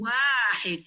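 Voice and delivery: "Wahid" with a drawn-out first syllable, wa; long WA. Shown as a demonstration. Teacher models the word for children, long WA in "Wahid". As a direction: stretch the "Wa" in "Wahid", keep "hid" short. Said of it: "Wanya panjang".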